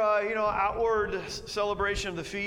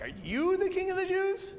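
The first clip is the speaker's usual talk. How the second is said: much higher, scoffing question; mock disbelief; 12 semitones higher than usual. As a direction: sneer in disbelief at the prisoner. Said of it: "So, like".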